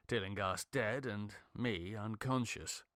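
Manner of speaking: usual speaking voice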